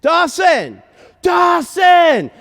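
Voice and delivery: high, drawn-out call across a crowd; yelling a name. Retold or imitated. Father yelling for his lost son up the sidewalk, building shout. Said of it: "yelling his name".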